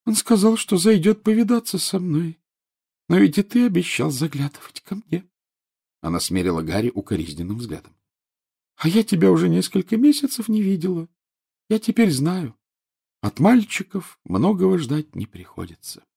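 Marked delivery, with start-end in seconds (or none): 0.0-5.3 put-on high-pitched sulky ghost girl; a reproachful little ghost girl; whiny ghost girl. Myrtle voiced by narrator. 8.8-16.0 put-on high-pitched sulky ghost girl; a reproachful little ghost girl; whiny ghost girl. Myrtle voiced by narrator.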